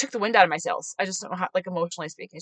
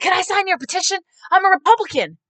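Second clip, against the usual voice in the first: imitated gushing, high and loud; excited supporter; 10 semitones higher, 6 dB louder than usual. Supporter greeting the candidate from his truck, excited. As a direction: act out a thrilled fan, pitched way up.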